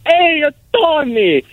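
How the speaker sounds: Giuseppe's voice. Retold or imitated. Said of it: "and he goes".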